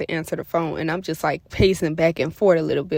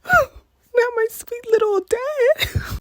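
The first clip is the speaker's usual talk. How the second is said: high, slow, wailing mock sob; mock crying; much slower than usual. Reading her dad's angry text in class, mock crying. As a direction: whimper it like a heartbroken kid.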